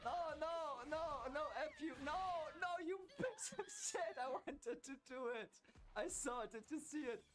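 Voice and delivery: squealing half-laughing frustration; high frantic whine. Real, not acted. Gamer losing the fight, squealing in frustration. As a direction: voice cracks up high, laughing in despair. Raging at opponent mid-fight.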